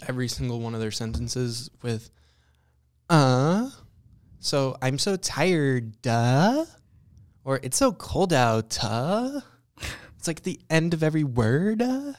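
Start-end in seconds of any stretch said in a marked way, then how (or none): 3.1-6.7 put-on drawn-out ditzy Valley girl; mock a girl ending with duh; Valley girl accent. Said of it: "It's the Valley girl accent". 7.6-9.4 put-on drawn-out ditzy Valley girl; mock a girl ending with duh; Valley girl accent. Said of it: "It's the Valley girl accent".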